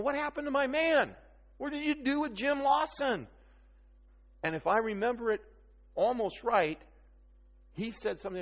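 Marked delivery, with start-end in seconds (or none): 0.0-3.3 high, amazed imitation of the wife; mock disbelief, pitched up high; astonished wife. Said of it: "And she's going".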